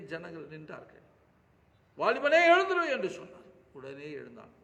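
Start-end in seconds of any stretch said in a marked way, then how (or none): 2.0-2.8 high, loud command to rise; commanding call. Acted. Jesus commanding the dead young man to rise, loud command.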